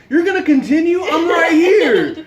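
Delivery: loud, high, incredulous shout; exasperated high-pitched retold outburst; outraged yell. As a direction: yell in disbelief, stretching the last words. Acted outrage at roommate continuing.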